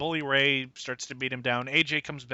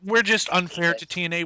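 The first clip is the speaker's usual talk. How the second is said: ordinary talk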